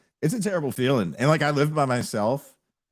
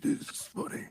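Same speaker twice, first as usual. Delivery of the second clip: unvoiced, softer, sarcastic remark; whispered sarcasm; 8 dB quieter than usual. Hurting father muttering sarcastically to his laughing daughter, whispered. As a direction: hiss it under the breath. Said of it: "And he's like".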